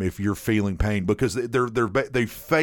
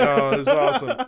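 high-pitched giggly glee; laughing delight; 14 semitones higher than usual. Retold or imitated. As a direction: squeal it through laughter. Delighted reaction to the accent, retold.

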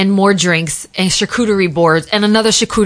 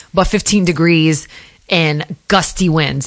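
ordinary delivery

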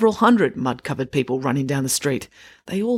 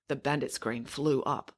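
plain reading voice

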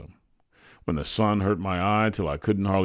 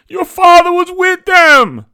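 slow, very high scream; horrified yell; 16 dB louder, slower than usual. A narrator's reading. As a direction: scream the terrible news, stretching it out. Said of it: "she yelled in horror".

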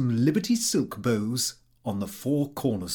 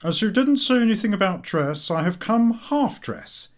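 higher, pleased-with-himself voice; higher, self-satisfied guest; smug Cummings; 8 semitones higher, 4 dB louder than usual. A narrator's reading. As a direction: announce it proudly, slowing at the end. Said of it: "The former said".

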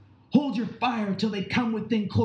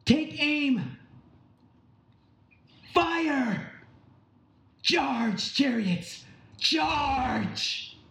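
high, drawn-out shouted commands; battle cry; 13 semitones higher, much slower than usual. Acted. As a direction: send the chariots charging with a yell. Commander orders the attack.

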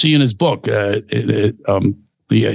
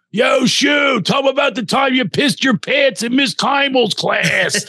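put-on drunk Philly heckler shouting, higher, slow; Philly accent heckle; 11 semitones higher, slower than usual. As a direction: yell it across the theater in a Philly accent. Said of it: "With the Philly accents".

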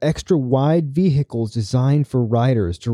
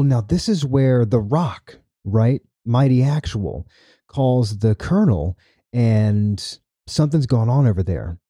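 normal talking voice

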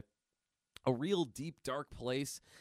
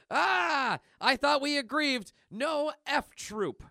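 loud outburst; 11 semitones higher, 8 dB louder, slower than usual. Acted.

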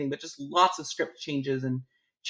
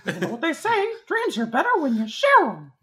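high-pitched cartoon mouse voice; Mickey Mouse; 10 semitones higher, 4 dB louder than usual. Co-host joining the Mickey joke, high mouse voice. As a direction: lift it high into a playful mouse squeak. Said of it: "that was a solid Mickey".

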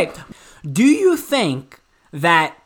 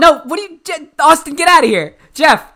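exasperated; 6 semitones higher, 6 dB louder than usual. Real, not acted. Host scolds guests for messing up.

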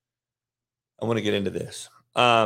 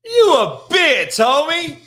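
higher, louder, slow and scornful; shouted insult; 10 semitones higher, 6 dB louder, slower than usual. Acted. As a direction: yell it down at him with contempt. Scolding the imagined boyfriend.

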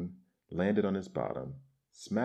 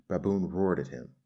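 ordinary delivery

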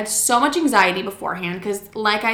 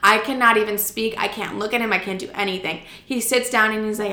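normal talking voice

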